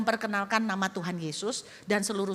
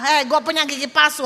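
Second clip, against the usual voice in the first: proud boast; 6 semitones higher, 8 dB louder, faster than usual. Pretend. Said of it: "kasih senyuman dengan bangga".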